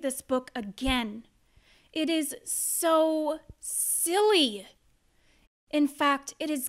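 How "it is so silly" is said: slow exasperated. Acted. Exasperated reader.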